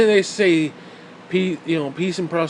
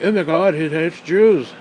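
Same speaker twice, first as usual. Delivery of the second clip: mock shocked listener; 5 dB louder, slower than usual.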